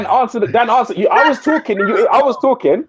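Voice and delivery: insisting he was cut off; shouting. Real, not acted. Complaining to Dan about being interrupted, shouting. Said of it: "You're shouting".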